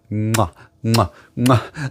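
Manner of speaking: quiet smacking kisses to the audience; soft blown kisses. Acted. Vlogger thanking viewers, soft blown kisses. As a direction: blow little kisses, softly.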